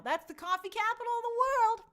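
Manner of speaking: a bright mock-grand proclamation; playful exclaim. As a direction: announce it like a fun fact. Reacting to fan in Seattle.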